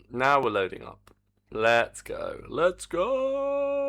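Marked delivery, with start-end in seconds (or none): 2.5-3.8 high, drawn-out hype call; excited cheer. Real, not acted. Cheering the game loading up.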